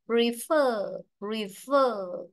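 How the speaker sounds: slow "prefer" with a long, falling second syllable; teacher demonstrating "prefer" as pre-FER; stress on FER. Shown as a demonstration. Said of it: "trọng âm rơi vào nó".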